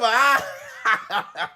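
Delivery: put-on cackle of amusement; mock laugh, drawn out; imitated laughing. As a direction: laugh like she finds it hilarious. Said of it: "She laughing like the shit is funny".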